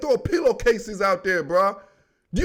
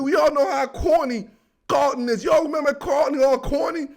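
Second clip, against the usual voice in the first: ordinary delivery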